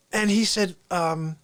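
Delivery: usual speaking voice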